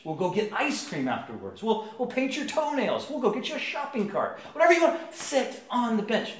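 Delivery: coaxing that climbs to a high frantic plea; desperate bargaining. Retold or imitated. Dad bribing his two-year-old to sit, increasingly desperate. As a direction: mock-desperate parent, voice shooting up.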